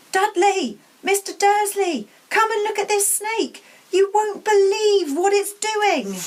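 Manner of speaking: high, loud excited yell across the room; deafening shout. Acted. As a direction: shout for everyone to come and look. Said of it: "a deafening shout behind Harry".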